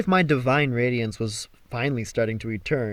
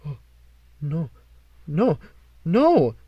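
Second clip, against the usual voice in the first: horrified; slower than usual. Acted. Apollo horrified at flab.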